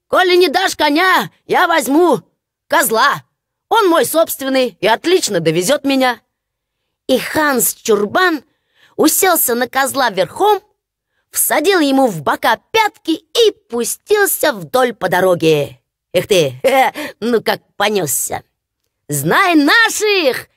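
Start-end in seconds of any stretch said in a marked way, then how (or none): 0.1-6.2 higher, brash simpleton voice; cheeky country fool who won't be denied; defiant simpleton. Acted. Hans defying his father, brash and high. 19.1-20.5 slow, much higher triumphant cry; shout. Acted. Hans riding off on the goat, triumphant shout. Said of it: "закричал он".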